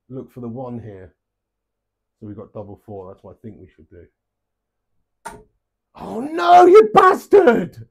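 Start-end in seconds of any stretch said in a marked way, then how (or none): 5.9-7.9 high, loud furious yell at the dart; frustrated shout. Real, not acted. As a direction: let the anger burst out, slow and drawn. Dart hits wrong double, swears.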